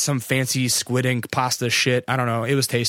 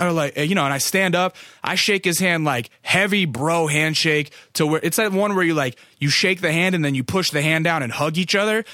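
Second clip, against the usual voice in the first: normal talking voice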